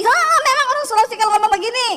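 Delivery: high, loud retort; loud Sulawesi-style retort; loud defiance. Retold or imitated. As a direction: snap back proudly that this is how Sulawesi people talk. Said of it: "Terus dia bilang".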